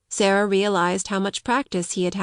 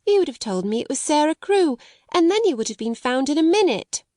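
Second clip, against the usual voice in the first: put-on higher-pitched boy's voice; self-reproachful little boy; 7 semitones higher than usual. A narrator's reading. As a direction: play a boy blaming himself.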